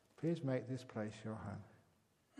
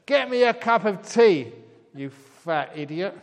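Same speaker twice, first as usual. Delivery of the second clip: much louder, higher, slow rude shout; rude shouted order; 7 semitones higher, 17 dB louder than usual. Retold or imitated. Traveller shouting at the flight attendant, copying the parrot. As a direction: copy the parrot's rudeness. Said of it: "he tried the parrot method".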